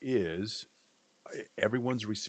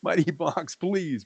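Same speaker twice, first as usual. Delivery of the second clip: mock pleading; 9 semitones higher, 5 dB louder, much slower than usual. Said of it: "I'm not yelling at you".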